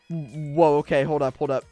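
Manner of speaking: usual speaking voice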